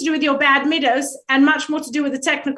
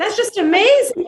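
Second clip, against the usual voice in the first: gushing awe; 7 semitones higher than usual. Retold or imitated. Mock awe at simple advice.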